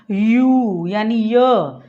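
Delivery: यू stretched very slowly into a clear य sound; slow sound demo. Shown as a demonstration. Teacher slowly shows that यू begins with the य sound. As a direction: draw out यू until it becomes य. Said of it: "slow mo में बोलता हूँ".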